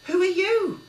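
high, loud shocked cry; shocked cry at the intruder; startled cry. A narrator's reading. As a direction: yelp at the stranger. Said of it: "cried Billy".

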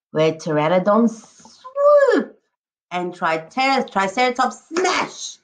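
lively, sing-song picture-book read-aloud; expressive storybook voice with a howl at the end; reading to children. Acted. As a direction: paint each jungle animal for the class. Teacher reads picture book aloud.

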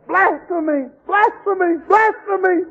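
high, falling cries of blasphemy; hammed-up scandalised church folk; mock outrage. Parents denouncing their son, in high mock outrage.

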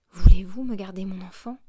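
loud, pressing plea; urgent plea. A narrator's reading. Mother urgently asking Thénardier to keep her child, loud. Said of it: "la regarda fixement et lui dit".

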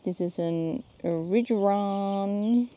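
ordinary delivery

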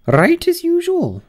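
high grand duchess voice; imperious plummy grande dame. Acted. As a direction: purr like a grand old duchess.

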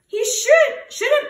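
high anxious plea; worried cry. A narrator's reading. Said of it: "cries Hare".

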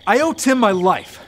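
ordinary talk, nothing marked

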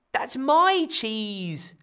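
put-on slow, sulky child voice; grumpy. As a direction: pout it out slowly. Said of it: "Ella said grumpily".

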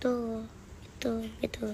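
whispered aside. Real, not acted. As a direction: drop to a breathy whisper. Kid trails into aside.

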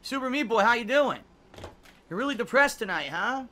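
high, loud taunting sing-song; mocking. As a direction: taunt him like a playground bully. Mocking a depressed streamer.

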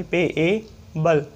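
पेएबल drawn out, पे, ए, बल each held; slow sounded-out payable. Shown as a demonstration. Teacher summing up the sounds of पेएबल, slow and drawn out. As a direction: let each vowel in पेएबल ring. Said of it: "फिर एबल की sound आई".

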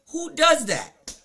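incredulous. Acted. Mock incredulous repeat.

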